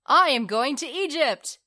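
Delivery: high, excited shout; loud cry. A narrator's reading. Swallow announcing to the harbor, crying out loudly. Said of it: "cried the swallow".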